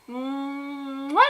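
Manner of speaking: big exaggerated "mwah" kiss; smacking sign-off kiss; blown kiss. Real, not acted. Signing off with a kiss.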